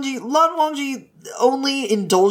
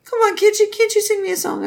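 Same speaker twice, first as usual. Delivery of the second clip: much higher, coaxing whine; wheedling plea; 11 semitones higher than usual. Acted. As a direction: beg sweetly for a song. Begging for a song.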